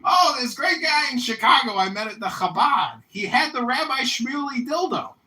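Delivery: loud, high mock voices; hammed-up dialogue. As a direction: belt out the silly exchange. Acted dad and son dialogue.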